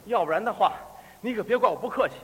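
a hint of veiled threat, kept low; lightly voiced robber. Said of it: "他跟我说话了。". (略压低嗓音，带点威胁)

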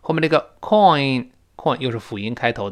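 ordinary delivery

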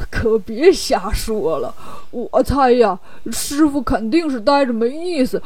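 easygoing oafish character voice; smiling Bajie. Acted. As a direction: brush off the worry in a goofy put-on voice. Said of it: "八戒笑着说".

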